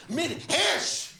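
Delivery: MIRHESH very high, HESH exploding after a short MIR; passionate pronunciation. Retold or imitated. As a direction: proclaim "Mirhesh" proudly.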